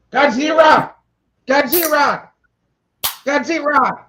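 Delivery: high, hammed-up Japanese accent. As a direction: shout the monster's name in a Japanese accent. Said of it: "Quick, get your Japanese accent".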